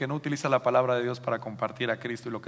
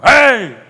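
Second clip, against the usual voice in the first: loud, high-pitched "hey!" whoop; hammed-up mariachi shout; mock charro cry; 10 semitones higher, 16 dB louder than usual. Peter imagined in a charro suit, mock charro cry. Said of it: "me imagino a Pedro con el traje de charro".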